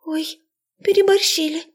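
very high squeaky voice; squeak. A narrator's reading. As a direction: squeak it out guiltily. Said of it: "пискнула Есения".

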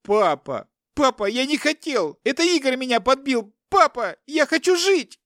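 very high, loud howl; panicked wail. A narrator's reading. Captive son begs his father to live, wailing. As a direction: wail for your life. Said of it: "завыл Руслан".

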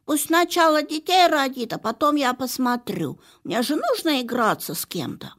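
indignant suitor. Acted. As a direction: protest like an offended suitor. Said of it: "возмутился кавалер".

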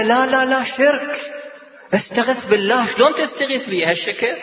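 mock indignant objection.

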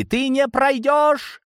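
very high, drawn-out heroic cry; heroic defiance. A narrator's reading. David bars Gromova's way, mock-heroic cry. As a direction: stretch it out high and grand.

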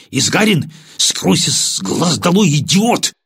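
high, slow, forceful order; angry dismissal. A narrator's reading. Lieutenant sending the disgraced sergeant away, furious. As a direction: spit out the dismissal in anger.

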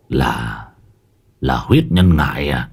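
low, stunned gasp of a question; a low, wide-eyed shaman's query; startled old shaman. A narrator's reading. Môn Phọt stunned by the smell, low startled question. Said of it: "Môn Phọt trợn mắt".

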